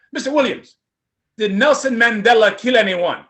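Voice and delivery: put-on loud Nigerian accent, questioning. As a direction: press him in a Nigerian accent. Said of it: "another Nigerian accent".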